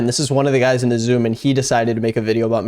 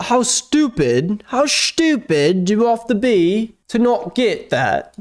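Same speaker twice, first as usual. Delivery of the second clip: mock outraged; 9 semitones higher, slower than usual. Mocking the guy's outrage.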